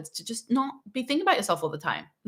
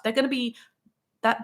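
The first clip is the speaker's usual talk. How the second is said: ordinary talk, nothing marked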